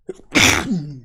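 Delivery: a real sudden sneeze; one sharp loud sneeze burst; sneezing. Real, not acted. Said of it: "I'm gonna sneeze".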